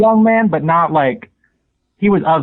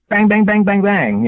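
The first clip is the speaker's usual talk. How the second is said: quick, higher string of bangs; imitated gunshots; 5 semitones higher, slower than usual. Caller recounts shots heard from couch, imitated gunfire. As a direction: mimic a volley of shots. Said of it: "you know, gunshots".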